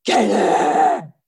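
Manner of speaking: loud, drawn-out mock monster roar; comic roar of rage; Incredible Hulk roar. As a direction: burst out like an enraged Hulk. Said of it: "like the Incredible Hulk".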